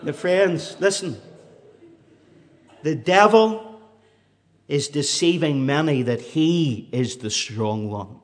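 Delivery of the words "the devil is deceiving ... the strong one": loud fiery preaching. Acted. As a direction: thunder it from the pulpit. Preaching at full force.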